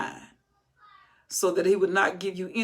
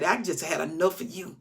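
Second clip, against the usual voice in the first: ordinary delivery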